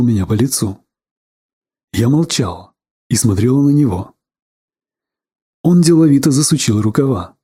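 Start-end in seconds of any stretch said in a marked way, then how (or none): none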